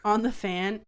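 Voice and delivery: usual speaking voice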